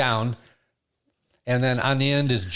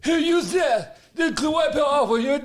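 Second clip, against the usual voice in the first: mock bossy voice; 11 semitones higher than usual. Voicing someone in anecdote.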